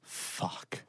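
near-silent whispered curse; voiceless muttered swear; hushed despair. Retold or imitated. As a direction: whisper it, defeated, to yourself. His despairing thought in the story.

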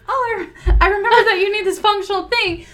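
high, sweet caring voice; imitating the boyfriend. Boyfriend offering practical help, high and sweet. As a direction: coo it like an attentive boyfriend. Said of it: "was just like".

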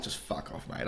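whispered, imitating a fed-up fan; muttered brush-off. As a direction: breathe it out, too sulky to talk. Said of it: "I'm like".

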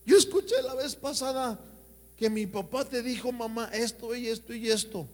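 put-on high child's voice telling on dad; child tattling. Preacher imitates a child telling mother what father said, high and childish. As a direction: play a kid repeating what dad said. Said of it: "tus hijos se los van a decir".

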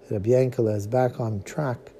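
ordinary talk, nothing marked

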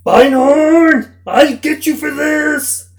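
shouted high, slow villain threat; sputtering foiled villain. Retold or imitated. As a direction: shake your fist, foiled villain. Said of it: "the dean would just be like".

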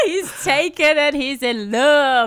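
gleeful hyping. Real, not acted. Co-host celebrating that friend is taken and in love, gleeful hyping.

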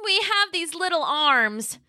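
high, slow plaintive little T-Rex. Acted. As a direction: draw out the complaint, leaning on have. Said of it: "Roxy cried".

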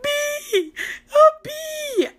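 very high, near-crying squeal of fear; panicked cry. Retold or imitated. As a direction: shriek like a terrified kid. Panicked child jumps from bee.

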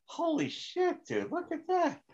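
very high-pitched, awestruck retelling; mock amazement. Friend spots the Jesus picture above his lookalike, mock amazed. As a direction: marvel in a squeaky, hammed-up voice.

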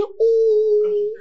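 mock a tiny pathetic shout; feeble shout. Nico asked to shout and manages only a high squeaky ooh. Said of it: "He said".

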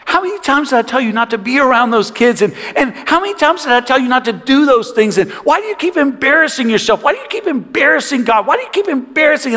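high-pitched exasperated telling-off; father's angry scolding. Retold or imitated. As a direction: rattle off accusing questions at a wayward son. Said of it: "I just let him have it".

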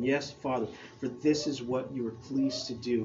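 soft, measured reading voice; scripture reading. Acted. Pastor reading Luke to the congregation, quiet and reverent.

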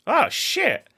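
high, loud cry of amazement; amazed outburst. Retold or imitated. Podcaster imitating his own showcase reaction, amazed outburst. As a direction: blurt it out, pitch leaping up. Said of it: "was me going".

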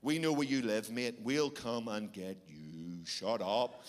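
Irish hard-man threat, dropping low and slow; put-on angry Irish menace; edgy angry Irish. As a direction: menace them slowly, sinking deep in pitch. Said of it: "sounds pretty edgy when you get angry".

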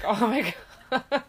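slow, laughing oh my God; exasperated laugh. Retold or imitated. Mom fighting an arching toddler, slow laughing sigh. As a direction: laugh it out at the end of your rope. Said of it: "so I'm like".

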